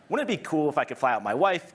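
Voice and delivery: ordinary talk, nothing marked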